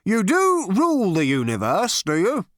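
high, loud, officious query; pompous interrogator's challenge; insistent questioning. A narrator's reading. Zarniwoop interrogates the man.

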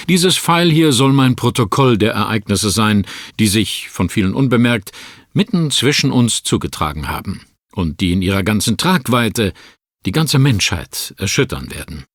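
ordinary delivery

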